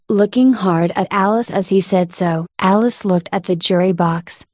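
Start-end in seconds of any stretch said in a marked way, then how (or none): none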